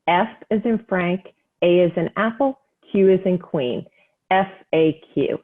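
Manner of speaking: slow, deliberate dictation with pauses between parts; slow read-out. Real, not acted. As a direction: spell it out slowly, letter by letter. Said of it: "I'm gonna read it out slowly".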